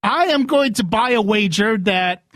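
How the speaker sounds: ordinary delivery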